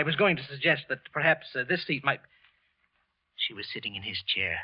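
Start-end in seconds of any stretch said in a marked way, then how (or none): 3.4-4.6 low dread. Acted. Wilson's inner voice as the widow sits down. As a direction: lower and softer, hushed inner thought.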